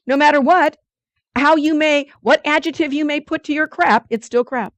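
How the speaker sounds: forceful punchline; emphatic preaching. Real, not acted. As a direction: drive it home like a preacher.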